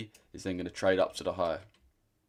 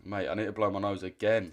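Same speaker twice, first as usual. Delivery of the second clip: normal talking voice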